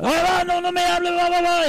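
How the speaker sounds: high, sharp retold outburst of an angry mother; a mother cutting off her pastor son in a high angry yell; mother yelling back. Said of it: "Y empezó a gritarme".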